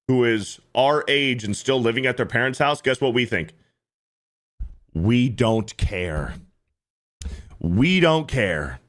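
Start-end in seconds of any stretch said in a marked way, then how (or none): 4.9-8.8 we don't care, drawn out low; slow, low dismissive shrug; slow deadpan. Real, not acted. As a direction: let each word drop slowly and flatly.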